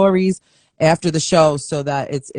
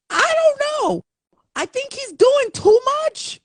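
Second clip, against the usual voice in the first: mock squeal. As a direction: tease with a shrieking high joke voice. Teasing praise of the son.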